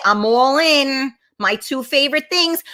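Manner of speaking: ordinary delivery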